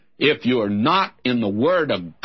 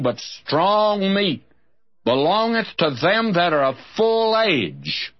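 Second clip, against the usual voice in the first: higher, measured Bible reading; scripture reading; 5 semitones higher than usual. Acted. Preacher reading Hebrews to his listeners. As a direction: read the verse slowly and solemnly.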